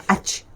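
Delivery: "Ach" with voiceless ch; old mistaken way. Shown as a demonstration. Teacher recalls the pupils' mistake, hissed "Ach". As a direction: end "Ach" in a puff instead of j. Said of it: "Macam mana?".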